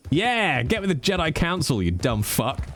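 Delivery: ordinary delivery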